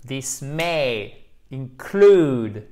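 include with a long, heavy CLUDE; very slow, leaning hard on the end of include; stress on second syllable. Shown as a demonstration. Teacher shows learners the stress, include drawn out on its second syllable. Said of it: "accent tonique sur la seconde syllabe".

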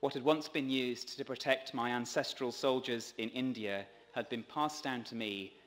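ordinary talk

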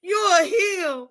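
ordinary talk, nothing marked

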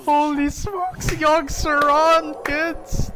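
high, drawn-out hype shout; amazed triumph. Real, not acted. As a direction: crow over the card, stretching the words. Celebrating the card's big play.

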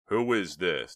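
loud, insistent question; demanding. A narrator's reading. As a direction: demand an answer, loudly. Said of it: "demanded Chuck".

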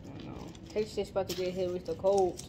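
ordinary talk

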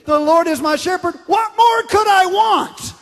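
high, loud, slowing on the question; climbing into a triumphant shout on the last words; joyful shouted paraphrase. Shown as a demonstration. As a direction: shout it out like a delighted exclamation. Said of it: "I like saying it like this".

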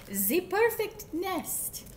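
put-on high, slow French-accented duck; badly faked French accent, drawn out; terrible French accent. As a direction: exclaim it in a hammed-up French accent. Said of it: "my accent is terrible".

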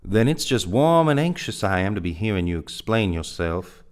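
sarcastic politeness with anger under it; angry demand. A narrator's reading. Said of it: "A flush of angry blood".

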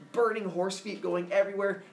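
ordinary delivery

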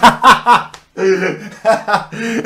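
big loud burst of laughter. Real, not acted. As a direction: crack up loudly. Bursts out at prank reveal. (gargalhada alta e forte)

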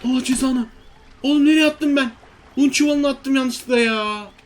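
high, loud dismay at a blunder; dismayed outburst. Real, not acted. Gamer realising he threw the flour sack wrongly, dismayed. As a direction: cry out at your own mistake.

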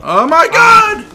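drawn-out ecstatic yell, very high; excited shout. Real, not acted. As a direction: stretch it out, screaming with excitement. Big reaction during fight.

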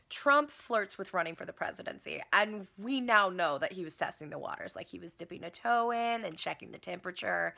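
ordinary talk

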